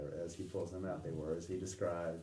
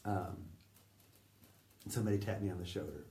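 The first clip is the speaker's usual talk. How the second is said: ordinary talk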